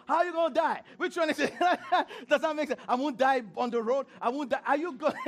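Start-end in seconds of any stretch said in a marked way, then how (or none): 0.1-2.8 loud, high, laughing disbelief; much higher and louder, laughing through the questions; incredulous laughing. Real, not acted. Preacher laughing at the prayers. 2.9-4.6 mock prayer. Mocking fearful prayers again.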